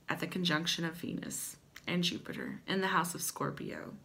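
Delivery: ordinary talk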